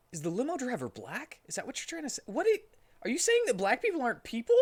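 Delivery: high, fast incredulous; mock outrage; indignant. Real, not acted. Indignant reaction to own story.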